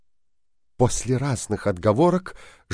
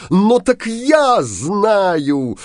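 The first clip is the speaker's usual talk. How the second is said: high, loud, slow villain roar; furious shout; 10 semitones higher, 7 dB louder, slower than usual. A narrator's reading. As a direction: bellow the accusation at her. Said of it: "закричал Синяя Борода". (яростный крик)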